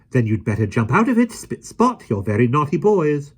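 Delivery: high, loud prim order; nanny scolding the naughty princes; brisk scolding. A narrator's reading. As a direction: snap them back into line. Mary Poppins scolding princes.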